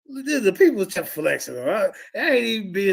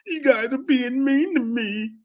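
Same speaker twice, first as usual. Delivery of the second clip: higher, slower mocking whine; mock whiny victim; 7 semitones higher than usual. Debater mocking an opponent as a victim, higher mock whine. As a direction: whine it like someone playing the victim. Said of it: "when she get treated like".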